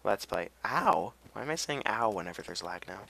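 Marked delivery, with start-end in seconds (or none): none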